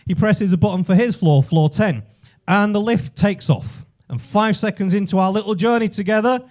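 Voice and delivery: ordinary delivery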